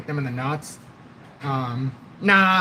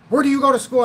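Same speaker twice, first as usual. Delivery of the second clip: pissed-off coach; 9 semitones higher, 6 dB louder, faster than usual. Retold or imitated. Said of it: "He's all pissed off".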